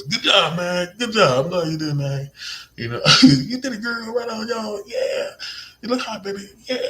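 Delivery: shower them with excited praise; high hyped praise. Shown as a demonstration. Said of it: "You gotta be like this".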